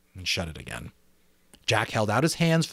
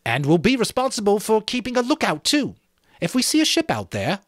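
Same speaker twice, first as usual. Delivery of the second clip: lightly voiced boy, higher, pleased with himself; proud schoolboy; 12 semitones higher, 5 dB louder than usual. Jack taking charge before the assembly, proud. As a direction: boast a little about being English.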